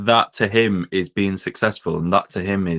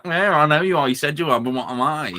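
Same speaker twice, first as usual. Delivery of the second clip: mocking playground retort, pitched higher; childish taunt; 6 semitones higher than usual. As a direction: taunt like a sulky kid, up high. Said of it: "resorts to being like".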